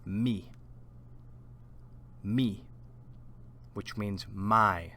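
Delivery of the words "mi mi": Mi said alone with a pure i vowel; Spanish mi. Shown as a demonstration. Teacher says Mi for students to repeat. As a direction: model Mi clearly. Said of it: "So, repeat after me".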